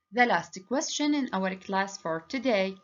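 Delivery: ordinary talk, nothing marked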